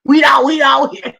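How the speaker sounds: very loud, high street holler. Retold or imitated. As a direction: holler it down the block. Calling out as on the block.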